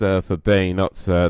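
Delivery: usual speaking voice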